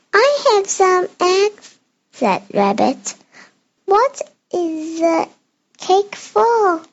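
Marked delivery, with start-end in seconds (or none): 0.1-1.8 higher, slow little rabbit voice; a lightly voiced small rabbit. 3.9-6.8 high, slow curious rabbit. Acted. Child voices Rabbit asking.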